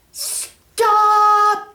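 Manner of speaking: very high, loud sustained cry; yelling. Acted. Mary yelling at elephants to stop, loud sustained call. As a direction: belt it out to halt the herd. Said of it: "yelled Mary".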